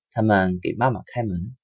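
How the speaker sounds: usual speaking voice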